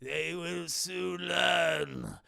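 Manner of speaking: slow, hushed, low villain voice; Palpatine. Retold or imitated. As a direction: intone it ominously, word by word. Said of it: "Palpatine's voice changes here".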